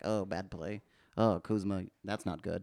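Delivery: low, soft, muted disappointment; understated mock commentary, quietly letting it drop; unbiased announcers. Imitating Lakers announcers who are not homers, low understated disapproval. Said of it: "I don't hear any hometown vibes from them".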